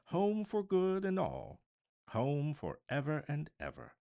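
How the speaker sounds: high, loud and bubbling with joy; a child overflowing with delight; brimful of glee. A narrator's reading. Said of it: "brimful of glee".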